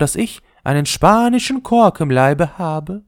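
very high, proud ball, rising on Kork; the ball flaunts her Spanish Kork, lifting it high; the ball boasting to the top about her Kork; snooty ball voice. Acted.

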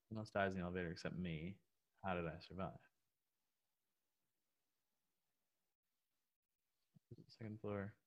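very soft, private puzzling; quiet read-back, lost in thought; reading to oneself. Real, not acted. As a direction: think it through half-aloud. Puzzling over riddle to himself.

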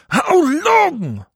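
the peak of the wail, a howl to heaven; shrieking cry. A narrator's reading. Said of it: "cried the voice of Jean-Marie".

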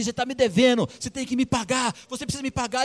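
high, singsong demand for payment; threatening creditor. Acted. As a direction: needle the debtor for his money. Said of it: "juntou o cara pelo colarinho e falou assim".

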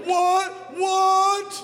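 high, held-out idiot "what"; stupid idiot act. Retold or imitated. Brother ignoring his pleading sister, high mock idiot yelp. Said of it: "doing my stupid idiot what, what thing".

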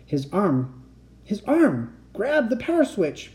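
urgent instruction rising in pitch; breathless urgency. A narrator's reading. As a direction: urge a friend on breathlessly. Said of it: "He turned breathlessly to me".

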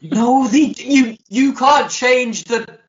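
high, loud, slow nerd protest; mock outrage. Host objects that Ewoks lack Jedi powers, mock outraged. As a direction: insist in hammed-up indignation.

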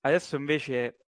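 restarting through laughter; laughing. Real, not acted. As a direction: laugh it off and carry on. Laughing after the camera fall.

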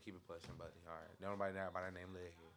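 low, very soft brush-off; dismissive doorman. Retold or imitated. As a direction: turn them away quietly, unbothered. Doorman turning someone away.

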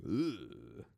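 low drawn-out "ugh", imitating Korean; low, hammed-up imitation grunt; mock Korean grunt. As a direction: let out a deep mock Korean groan. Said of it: "Korean's more like".

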